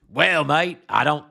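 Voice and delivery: hammed-up Australian accent, leaning on mate; Justin Wolfers Australian impression. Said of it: "my Justin Wolfers is terrible".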